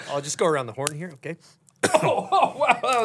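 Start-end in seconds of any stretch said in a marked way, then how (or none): 1.9-3.0 trying not to laugh. Real, not acted. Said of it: "try to keep a straight face".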